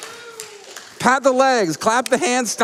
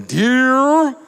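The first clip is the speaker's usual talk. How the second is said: imitated yell; 4 semitones higher, 4 dB louder than usual. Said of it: "people yelling".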